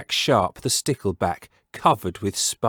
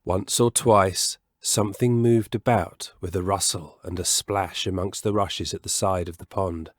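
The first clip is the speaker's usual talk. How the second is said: ordinary delivery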